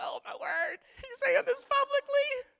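very high, slow whimpering mock voice; squeaky, drawn-out mock mortification; mortified Mike. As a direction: wail softly in hammed-up embarrassment. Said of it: "Mike's just squirming. He's going".

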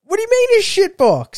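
high-pitched, incredulous challenge; real affronted squawk of a question; indignant disbelief. Real, not acted. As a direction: voice shooting up in protest. Indignant at friend insulting the car.

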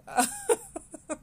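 quick high-pitched laugh. Real, not acted. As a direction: burst out laughing at yourself. Laughs at herself.

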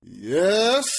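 drawn-out yes, swooping up high; mock irked reply to a pest; imitated annoyance. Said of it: "he turns with the most, like, annoying".

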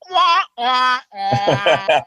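muffled trombone wah wah sound; Debbie Downer wah wah. Retold or imitated. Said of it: "that Debbie Downer".

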